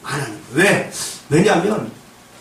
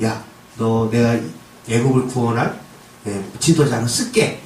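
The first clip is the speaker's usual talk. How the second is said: slow, low, unhurried voice of God calling; God calling Moses, slow and low; voicing God; 8 semitones lower, slower than usual. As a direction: speak as God, slowly and low.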